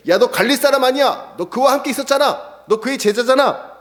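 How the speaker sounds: high, taunting jeer; a man mocking Peter as a Galilean. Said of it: "조롱하듯이".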